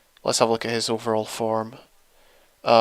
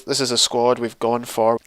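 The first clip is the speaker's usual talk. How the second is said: ordinary talk, nothing marked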